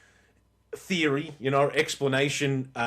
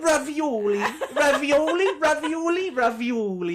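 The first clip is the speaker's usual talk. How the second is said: put-on ravioli rolled in an over-the-top Italian accent, higher and laughing; mock Italian accent; 11 semitones higher, 4 dB louder than usual. Mocking his ex ordering ravioli, exaggerated Italian accent. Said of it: "in an Italian accent".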